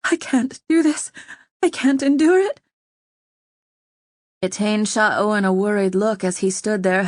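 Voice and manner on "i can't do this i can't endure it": much higher, breathless and desperate; queen losing control, quick breath; panicking. A narrator's reading. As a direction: break down in rising panic. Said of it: "Her breath started to quicken".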